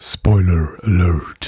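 low, very slow trailer announcer; movie trailer voice. Acted. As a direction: boom it like a cinema trailer. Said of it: "Ich hab mir eine neue Trailerstimme zugelegt".

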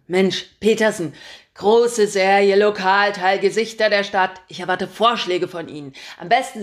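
loud, brash editor barking orders; a pushy chief editor who loves his own voice; booming boss. Acted. Chief editor calling his reporter, booming and pushy.

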